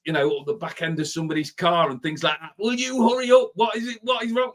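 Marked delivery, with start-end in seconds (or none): 2.6-4.5 hammed-up impatient yelling; mock road rage. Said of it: "I'm getting really angry".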